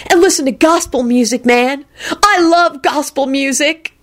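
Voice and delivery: high, eager dude voice; hollering. A narrator's reading. Diner courts the unimpressed servant, hollering. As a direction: enthuse up high, drawing out the last words. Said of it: "Kenny hollered".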